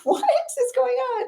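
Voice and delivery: high, slow mock amazement; mock disbelief. Remembering photo dates, high slow amazed squeak.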